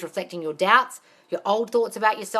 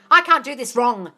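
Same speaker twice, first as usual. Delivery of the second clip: 1 bold self-affirmation; 4 semitones higher, 8 dB louder than usual. Shown as a demonstration. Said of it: "Tell your mind".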